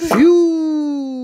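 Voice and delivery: high, loud Siu cry; goal-celebration yell up high; Siu celebration shout. Pretend. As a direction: belt out the celebration call.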